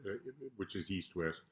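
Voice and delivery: normal talking voice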